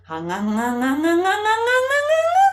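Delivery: "nga" climbing up to very high; rising pitch. Shown as a demonstration. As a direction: slide "nga" upward step by step. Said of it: "Going up".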